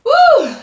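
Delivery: very high, loud whoop; excited whoop. Real, not acted. Eater reacting to the food with a loud whoop. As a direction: let out a big whoop of delight.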